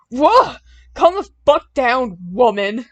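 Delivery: loud furious yell; angry scream. A narrator's reading. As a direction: scream it at her, startled and mad. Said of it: "James screamed".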